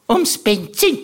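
a hint of the aunt's voice; Tante Mari nachgemacht. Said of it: "allen noch einmal gesagt hat". (leicht höhere Tantenstimme)

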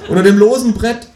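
loud, high wail of confession; desperate cry. A narrator's reading. Narrator giving up the hiding place, desperate cry. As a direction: cry it out high, in mock despair. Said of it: "schrei ich verzweifelt".

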